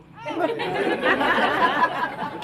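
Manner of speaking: loud burst of laughter. Real, not acted. Laughing loudly at her own joke about the stolen boyfriend.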